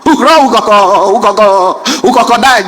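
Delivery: heavily stuttered model pronunciation; stammering teacher. Retold or imitated. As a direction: block on each word and start it over. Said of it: "My friend happened to be a stammerer".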